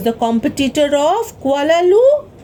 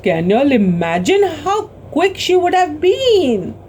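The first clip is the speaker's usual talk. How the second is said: ordinary delivery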